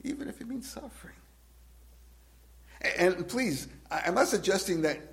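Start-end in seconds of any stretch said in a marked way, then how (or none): 0.0-1.2 much higher, fast, quiet repeat of the line. Shown as a demonstration. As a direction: fire it back high and quick after a laugh.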